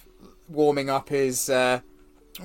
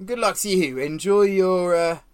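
a lightly voiced gruff NPC, louder; Garrick's voice; 2 semitones higher, 5 dB louder than usual. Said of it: "sort of looks up and goes".

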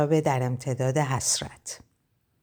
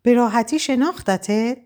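upset; 8 semitones higher than usual. A narrator's reading. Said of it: "مژگان با ناراحتی گفت".

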